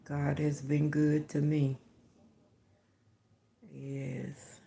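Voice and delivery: normal talking voice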